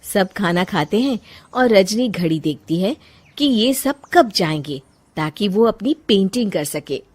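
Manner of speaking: usual speaking voice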